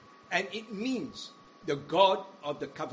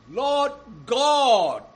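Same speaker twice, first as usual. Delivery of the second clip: loud, much higher anguished appeal; crying out in prayer; 4 semitones higher, 10 dB louder than usual. Acted. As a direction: call out to God from the depths. Prophet's prayer voiced in sermon.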